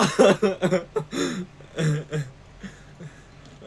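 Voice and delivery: loud high wheezing laughter; helpless wheezing laugh. Real, not acted. Laughing fit after choking.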